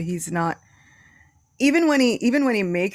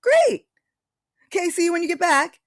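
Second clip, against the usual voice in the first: much higher, louder, quick cheery reply; cheerful excitement; 12 semitones higher, 6 dB louder than usual. Retold or imitated. Boys happily send their friend off, excited. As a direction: chirp it like carefree kids. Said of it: "What they say is".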